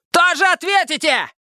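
very high, squealing warning; agitated threat. A narrator's reading. Detainee warning police about his split lip, high agitated threat. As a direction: threaten them in a thin outraged voice. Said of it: "снова взвился Лысый".

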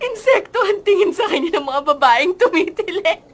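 talking through giggles; laughing. Real, not acted. Girl laughing as she mocks the boy's fans. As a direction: push the words out between laughs. Laughing while mocking Calvin.

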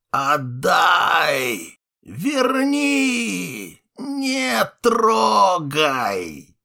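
high, strained, grating wail; grating howl. Acted. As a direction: howl it out, harsh and desperate. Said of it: "отвратный, скрипучий, надсадный вой".

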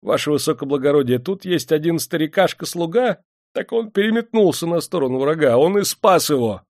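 high, anxious informer; servile, eager high voice; fawning frightened clerk. A narrator's reading. Frightened clerk pleads, informing on servant.

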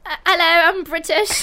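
a lightly put-on British accent; mock-proper British greeting. Friends dared into trying British accents, lightly put-on accent. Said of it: "We'll all do our British accents".

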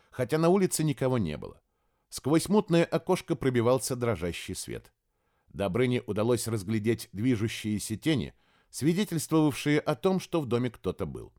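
ordinary delivery, nothing marked

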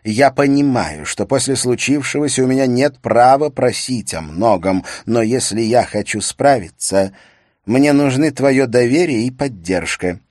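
pleading husband. Acted. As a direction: urge your wife to trust you, voice up. Husband pleads for her support.

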